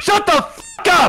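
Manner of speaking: high, strained outburst; upset yell. Real, not acted. Losing player telling his laughing friend off, upset yell.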